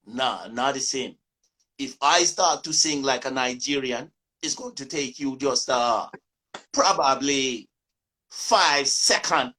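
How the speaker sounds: imitating Jamaican friends in patois, mocking; a lightly done Jamaican patois teasing a fake. Jamaican friends tease him in Jamaican patois. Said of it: "they always used to say".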